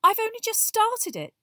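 high, loud, brisk explanation; brisk Hermione. A narrator's reading. Girl explaining she has only just started her society, brisk. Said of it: "said Hermione briskly".

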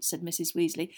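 usual speaking voice

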